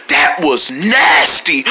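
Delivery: low, slow "nasty", stretched out in disgust; drawn-out NASTY. Acted. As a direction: stretch the word "nasty" very long. Insists on disgust.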